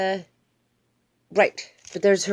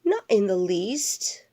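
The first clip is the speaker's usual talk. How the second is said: ordinary delivery, nothing marked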